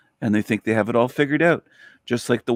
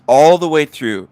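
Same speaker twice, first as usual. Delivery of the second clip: louder, hitting all; loud emphasis; 4 semitones higher, 7 dB louder than usual. Real, not acted. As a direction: stress ALL loudly. Repeated for emphasis.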